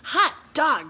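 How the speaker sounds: loud, high cheer; cheering kids. Acted. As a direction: shout it like kids who won. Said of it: "cried the class".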